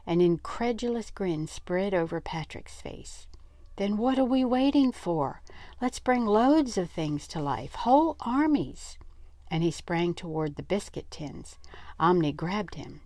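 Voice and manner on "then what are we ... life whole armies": high, eager burst; boy thrilled by a plan, higher and louder; excited. Acted. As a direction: rush in with excitement. Patrick eager to animate toys.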